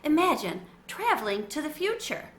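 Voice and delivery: ordinary delivery, nothing marked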